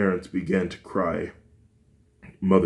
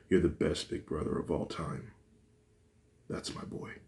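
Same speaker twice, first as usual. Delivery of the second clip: tender father; 8 dB quieter than usual. Acted. Father comforting son.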